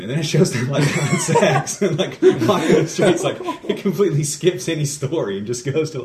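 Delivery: high, loud, helpless laughter through words; laughing while talking. Real, not acted. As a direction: tell it while cracking up. Cracking up while retelling film.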